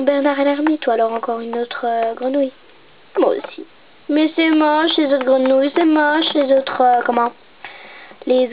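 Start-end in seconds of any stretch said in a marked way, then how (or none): none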